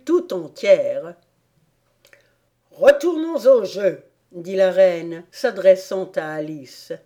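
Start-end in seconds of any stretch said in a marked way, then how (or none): 2.7-4.0 commanding order. Acted. Queen orders back to game.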